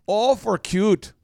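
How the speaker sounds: slow, drawn-out Minnesotan; Minnesotan accent. Acted. Minnesotan admiring a baby, slow mock accent. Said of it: "you'll start to speak Minnesotan".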